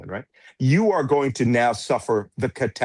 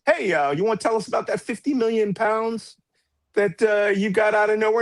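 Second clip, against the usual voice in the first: a hint of a pointed BBC interviewer, higher; 7 semitones higher than usual. Retelling a BBC presenter grilling Farage, lightly voiced. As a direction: press him like a reporter. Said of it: "interview the other day from the B B C".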